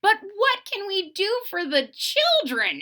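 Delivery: put-on high boyish voice; eager little brother asking; Brother John. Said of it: "asked Brother John".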